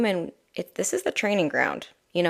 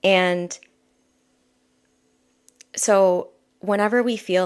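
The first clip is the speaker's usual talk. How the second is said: normal talking voice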